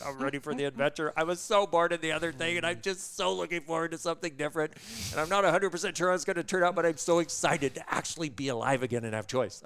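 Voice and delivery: high, loud, fast gushing joy; excited. Retold or imitated. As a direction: rush it out like a freed woman. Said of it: "She went".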